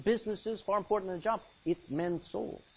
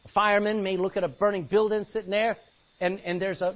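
ordinary talk

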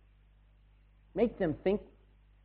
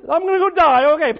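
much higher and louder cartoon penguin, cheerfully doomed; silly penguin voice; 12 semitones higher, 14 dB louder than usual. Preacher plays a penguin following the others off the edge, high silly squeal. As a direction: squeal it like a dim penguin about to jump.

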